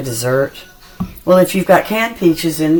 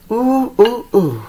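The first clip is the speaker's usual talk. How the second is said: excited ooh; 7 semitones higher, slower than usual. Real, not acted. Tasting fresh cobbler.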